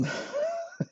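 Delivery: quick embarrassed giggle; a light laugh breaking through; short laugh. Real, not acted. Laughs at own hiccup.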